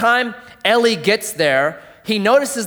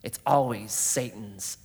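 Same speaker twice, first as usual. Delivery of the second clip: normal talking voice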